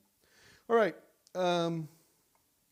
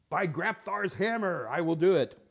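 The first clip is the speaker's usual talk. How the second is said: mock heroic oath; 3 semitones higher, 6 dB louder than usual. Vowing to finally take the course, hammed-up heroic oath. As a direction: swear it like a hammy sci-fi hero.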